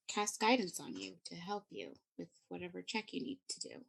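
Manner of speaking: ordinary talk, nothing marked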